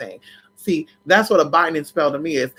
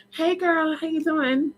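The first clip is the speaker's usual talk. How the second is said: sarcastic sweet greeting; 11 semitones higher, 5 dB quieter than usual. Real, not acted.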